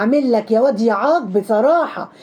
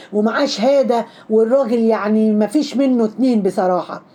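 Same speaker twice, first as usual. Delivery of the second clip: ordinary delivery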